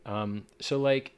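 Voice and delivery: ordinary talk, nothing marked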